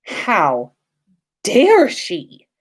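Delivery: usual speaking voice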